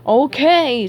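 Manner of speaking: exasperated interruption; interjection. A narrator's reading. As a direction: break up the squabble firmly. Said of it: "Sage interjected".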